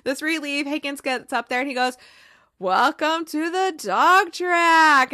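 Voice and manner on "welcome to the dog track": loud, high, drawn-out announcement; mock announcer call. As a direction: imitate a hype man calling to a crowd. Higgins acted addressing the crowd.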